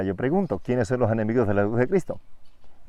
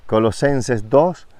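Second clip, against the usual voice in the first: ordinary delivery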